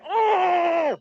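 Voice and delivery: high drawn-out "Oh!" of frustration; frustrated groan. Retold or imitated. Preacher asking if the listeners ever feel this, long frustrated groan. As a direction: let out a long sulky groan. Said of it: "Ever get like".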